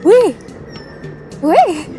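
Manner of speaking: ordinary talk, nothing marked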